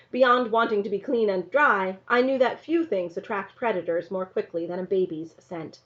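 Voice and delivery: usual speaking voice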